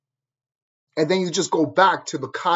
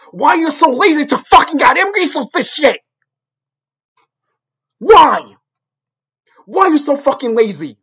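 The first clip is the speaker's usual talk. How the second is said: exasperated shouting, higher and louder; angry yelling; 8 semitones higher, 6 dB louder than usual. Real, not acted. Ranting at viewers too lazy to research, furious shouting. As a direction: hurl the question at them in real fury.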